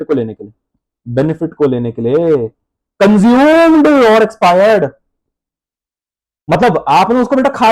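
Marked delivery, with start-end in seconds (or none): 3.0-4.9 very slow, louder, each word stretched; drawn-out dictation. Shown as a demonstration. Teacher dictating to students, stretched and louder. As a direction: stretch every word for the class to write.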